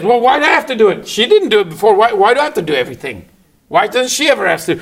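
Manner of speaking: put-on high, loud, fast complaining kid; exaggerated rapid-fire "not fair" protest; whining protest. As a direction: imitate a child arguing over chores. Voicing child whining about chores.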